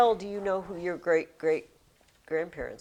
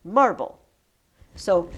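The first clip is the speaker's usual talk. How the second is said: normal talking voice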